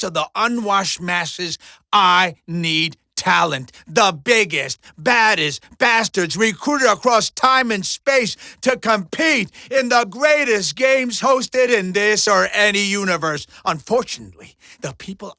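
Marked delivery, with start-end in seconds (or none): 1.9-13.5 loud, emphatic showman proclamation; bombastic sales pitch. Acted. Mojo pitching his greatest games to Deadpool, bombastic and loud. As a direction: hammer out every big word for the crowd.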